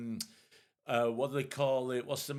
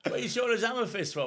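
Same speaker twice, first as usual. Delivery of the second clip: ordinary talk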